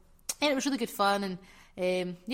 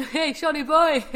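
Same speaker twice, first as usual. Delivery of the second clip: loud teasing call, higher; playful shout; 6 semitones higher, 9 dB louder, slower than usual. Real, not acted. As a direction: call him out cheekily.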